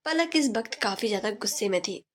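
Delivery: ordinary delivery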